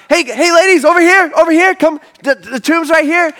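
high, loud, eager calling over; pumped angel. Acted. Pumped angel calling the ladies over to the tomb, excited. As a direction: wave them over with overexcited urgency. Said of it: "this angel is pumped".

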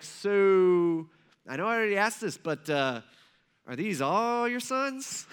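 long stretched "so", then hesitant asking; awkward question. Acted. Samuel asking Jesse about missing sons, awkward and drawn-out. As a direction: ease reluctantly into it, rising high at the end. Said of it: "the most awkward question that he could ask".

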